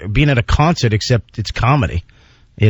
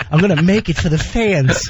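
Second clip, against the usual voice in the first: lightly voiced struggling movie boxer, slightly higher; a hint of a huffing boxer hero; Rocky; 4 semitones higher than usual. Comic mocking himself on a hard climb, lightly voiced Rocky. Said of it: "like, like a fat Rocky".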